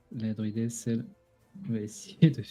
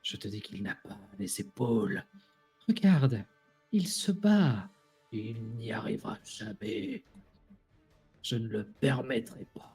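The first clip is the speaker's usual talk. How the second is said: voices in the head; 2 semitones lower, 5 dB quieter than usual. Acted. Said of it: "Tu entends".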